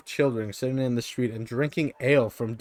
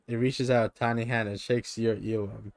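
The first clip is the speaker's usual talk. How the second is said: ordinary talk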